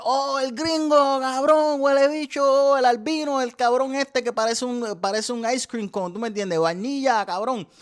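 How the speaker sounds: high, loud hyped Puerto Rican partygoer; partygoers cheering. Retold or imitated. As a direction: shout it like a thrilled party crowd. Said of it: "They're like".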